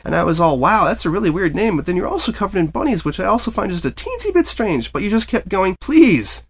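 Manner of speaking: high, bouncy chatterbox pony voice; breathless cheerful pony chatter; bubbly Pinkie Pie. A narrator's reading. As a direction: gush it out like an over-eager party pony.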